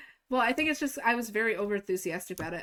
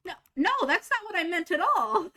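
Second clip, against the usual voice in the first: high, louder protest; insistent denial; 10 semitones higher, 5 dB louder than usual. Retold or imitated. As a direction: protest fast against the mix-up. Protesting the misunderstanding.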